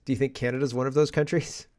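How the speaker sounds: ordinary delivery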